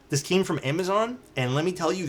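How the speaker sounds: ordinary talk, nothing marked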